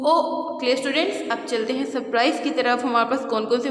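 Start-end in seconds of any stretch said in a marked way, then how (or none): 0.1-0.1 brief, abrupt ओ; short vowel sound. Shown as a demonstration. Teacher contrasting the second sound for students, short ओ. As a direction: keep ओ to a quick short oo. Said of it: "second sound short है".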